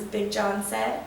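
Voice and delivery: plain reading voice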